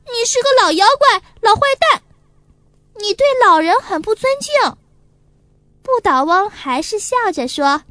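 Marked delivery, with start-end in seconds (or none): 0.1-4.8 high, fast tearful yelling; crying shout. A narrator's reading. Angry little boy crying and yelling insults at the toy, high and fast. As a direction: bawl the insults out. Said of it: "哭着嚷".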